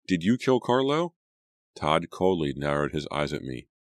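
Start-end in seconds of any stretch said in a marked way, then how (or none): 0.1-1.1 higher, pressing demand; accusing cry. A narrator's reading. Natalie accuses Todd of killing Carlo, high and insistent. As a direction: push the accusation home. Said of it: "I cried".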